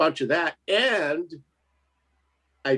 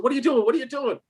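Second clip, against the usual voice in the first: mock wife scolding; 10 semitones higher, faster than usual.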